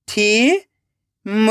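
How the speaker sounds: थीम split into थी, long pause, म; rising थी, then म alone; syllable by syllable. Shown as a demonstration. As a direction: sound out थीम very slowly in two parts. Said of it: "एक एक अक्षर का उच्चारण करना है".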